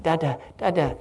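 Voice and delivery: a lightly voiced toddler's "Da, da, da"; toddler babble. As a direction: babble like a small child wanting dad. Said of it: "He's like".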